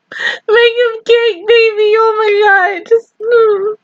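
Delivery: high, slow, crying voice; begging through happy tears; tearful plea. Real, not acted.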